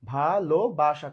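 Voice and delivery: ভালোবাসা very slowly as "bha - lo - ba - sha"; syllable by syllable. Shown as a demonstration. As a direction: stretch each of the four syllables of ভালোবাসা. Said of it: "চারবার উচ্চারণ করলাম". (ভালোবাসা চার ভাগে, টেনে টেনে)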